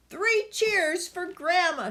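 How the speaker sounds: put-on high cheering boy; excited child's cheer; shouting. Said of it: "shouted Billy".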